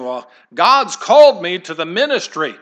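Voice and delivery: hammed-up testimony, projected loudly; churchgoer announcing a calling. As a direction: imitate someone grandly declaring their calling. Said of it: "think of people saying".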